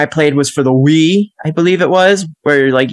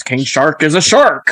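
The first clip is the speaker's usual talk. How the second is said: cartoon shark catchphrase voice; put-on shark character voice; imitating King Shark. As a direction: say it like a simple shark. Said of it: "every time he walks around".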